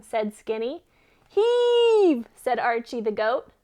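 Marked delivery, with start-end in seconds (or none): 1.3-2.3 loud, high call of heave; shouted command. Acted. Goat urging hen to heave.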